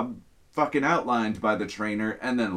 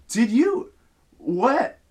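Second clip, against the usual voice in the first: high, loud, broken-off starts in disbelief; re-enacted sputtering disbelief; shocked stammering; 11 semitones higher, 6 dB louder than usual. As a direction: act out stammering in shock, building to an outburst. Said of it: "as I'm stammering there".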